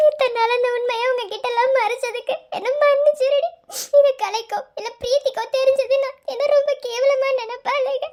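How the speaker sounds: anguished and fretful; tearful apology. Acted. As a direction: beg forgiveness through sobs.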